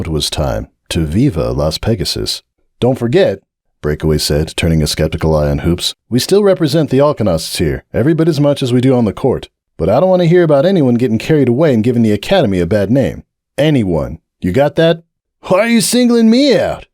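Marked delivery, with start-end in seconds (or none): 2.8-15.0 firm, faster team captain lecture; captain warning the team, firm and brisk; stern captain warning. Acted. Breakaway warns the team. 15.4-16.9 higher, indignant protest; defensive college colt; Hoops protesting. Acted.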